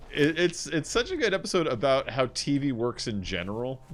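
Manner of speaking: usual speaking voice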